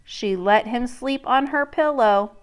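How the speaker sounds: plain reading voice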